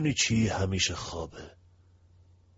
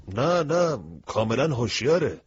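eager correction; 4 semitones higher, 3 dB louder than usual. Acted. Mino quickly correcting the narrator.

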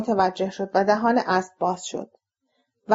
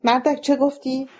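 higher, understated angry demand; mild angry challenge; 4 semitones higher than usual. A narrator's reading. Bacon turns back on the heckler, mildly angry. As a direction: challenge him with contained anger, pitched up. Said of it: "با خشم و مشت گره کرده".

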